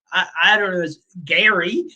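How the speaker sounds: usual speaking voice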